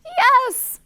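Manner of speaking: high, loud cheering yes; excited cry. Acted. Animals agreeing to help the girl bird, excited cry. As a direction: cry it out like a whole gang of animals. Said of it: "cried the pig, the dog".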